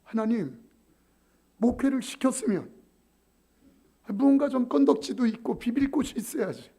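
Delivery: loud, high, pleading protest at God; arguing with God. Retold or imitated. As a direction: cry out your complaint to heaven. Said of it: "하나님 앞에 따졌어요".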